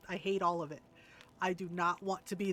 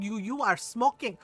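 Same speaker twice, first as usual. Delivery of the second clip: put-on louder Filipino-accented accusation; Filipino mother; 5 dB louder than usual. Filipino mom sniffing out smoke, accented accusation. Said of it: "our little tiny Filipino mother".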